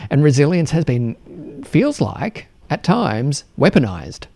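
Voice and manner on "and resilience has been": ordinary delivery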